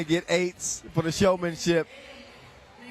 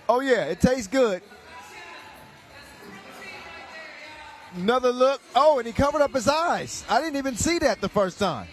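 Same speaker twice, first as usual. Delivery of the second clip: excited; 6 semitones higher than usual. Real, not acted. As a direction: call it like it's unbelievable. Commentating a wild no-look dunk.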